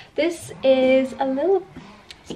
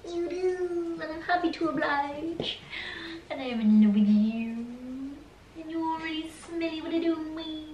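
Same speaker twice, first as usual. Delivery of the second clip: soft doggy cooing; 5 semitones higher, 6 dB quieter than usual. Real, not acted. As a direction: coo lovingly to a pet, very softly. Cooing love to her dog.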